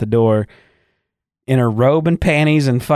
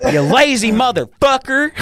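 much higher, slightly louder mock insult; mock insulting wife; 12 semitones higher, 3 dB louder than usual. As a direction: spit it out as the fed-up wife, pitched up. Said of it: "and she goes".

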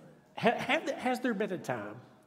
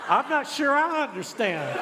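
high, loud mimicking of a voice assistant; amused echo; 8 semitones higher, 5 dB louder than usual. Preacher echoing the device that broke into his sermon, amused disbelief. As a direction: repeat the gadget's words, incredulous and tickled. Echoes the device that interrupted him.

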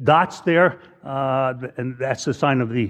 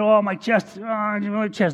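crying; 6 semitones higher, slower than usual. Retold or imitated. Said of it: "He's crying".

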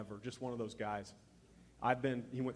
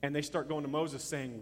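ordinary talk, nothing marked

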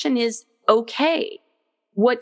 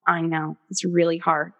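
soothing murmur; 2 semitones lower than usual. Retold or imitated. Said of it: "sitting with her while she was crying".

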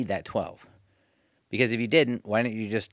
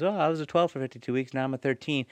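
high, self-satisfied mock voice; smug employee; 6 semitones higher than usual. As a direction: act entitled and pleased. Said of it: "they goes".

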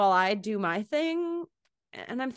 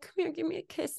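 high, soft pretend puppy plea; small, pitched-up playful voice; sad puppy; 9 semitones higher, 6 dB quieter than usual. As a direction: beg softly as a sad little puppy. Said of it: "I'm a sad puppy".

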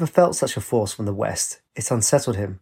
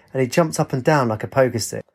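ordinary delivery

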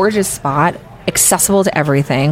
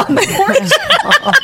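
words breaking into real laughter, much higher; laughing; much slower than usual. Real, not acted. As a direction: laugh through it as you tell it. Laughing at the fire police story.

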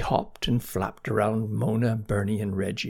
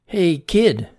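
higher, louder friendly hail; calling out; 7 semitones higher, 8 dB louder than usual. A narrator's reading. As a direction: call over to the little one. Frog calls out to chick.

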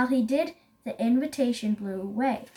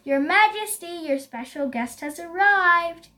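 raised, louder herald's call; 5 semitones higher, 4 dB louder than usual. A narrator's reading. Someone calls to Lion from the bushes, calling out. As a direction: announce a guest to royalty.

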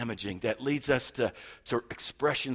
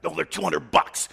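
retold snap; 3 semitones higher, 7 dB louder than usual. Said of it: "And I'm like".

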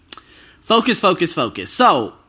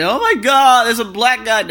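mock neighbor; 5 semitones higher, 5 dB louder than usual. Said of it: "they're gonna start saying".

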